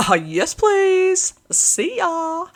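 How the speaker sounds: high, slow, eager captives' reply; eager captives. Retold or imitated. Captives answering Jesus, high and eager. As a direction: gush it out like grateful freed souls. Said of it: "And they're all like".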